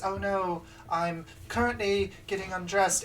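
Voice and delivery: high, loud princess voice; cartoon princess. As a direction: play the prim storybook princess. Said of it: "the princess says".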